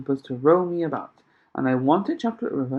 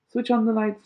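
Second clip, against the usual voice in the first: shouted; 7 semitones higher than usual. A narrator's reading. Mister Wonka ordering the lights on, shouting. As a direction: call the order down the tunnel. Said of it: "shouted Mister Wonka".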